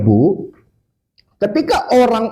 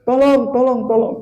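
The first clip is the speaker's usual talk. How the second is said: calling for help; 5 semitones higher than usual. Retold or imitated. Stranded grandmother to anyone passing, mock cry for help. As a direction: imitate a stranded granny calling out. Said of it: "nenek itu minta tolong".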